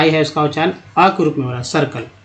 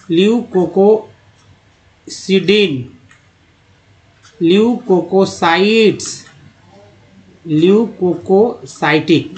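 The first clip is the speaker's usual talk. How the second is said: drawn-out syllables with breaks inside words; slow word modelling; slower than usual. Shown as a demonstration. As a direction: read each term slowly, part by part. Teacher models terms part by part.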